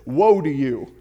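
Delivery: loud, low, stern warning; thundering prophet voice. Retold or imitated. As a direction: boom it like an angry prophet. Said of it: "he usually started like this".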